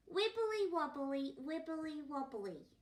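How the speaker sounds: put-on high, loud babbling baby voice. The baby chimes in at the family table, babbling high. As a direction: babble it like a happy toddler. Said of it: "went the baby".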